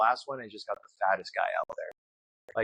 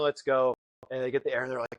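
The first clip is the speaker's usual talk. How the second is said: ordinary talk, nothing marked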